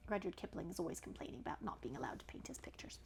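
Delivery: soft whispered aside. Real, not acted. Whispering an aside to the listening child.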